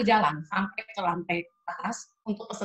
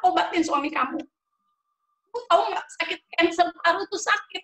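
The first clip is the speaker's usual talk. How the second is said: tegas mendesak; 10 semitones higher, 4 dB louder than usual. Retold or imitated. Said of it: "Dia bilang".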